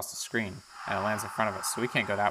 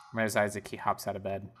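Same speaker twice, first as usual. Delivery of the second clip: ordinary talk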